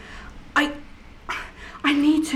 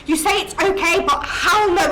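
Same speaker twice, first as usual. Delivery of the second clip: rising angry protest, louder; angry outburst; 5 semitones higher, 4 dB louder than usual. Acted. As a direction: flare up in protest. Dead girl rages at comfort.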